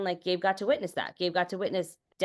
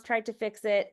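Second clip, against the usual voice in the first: normal talking voice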